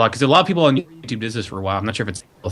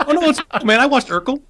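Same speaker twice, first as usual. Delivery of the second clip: high nervous voice; 13 semitones higher than usual. Retold or imitated. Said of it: "and then I said".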